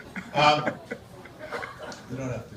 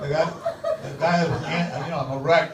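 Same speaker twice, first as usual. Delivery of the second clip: ordinary talk, nothing marked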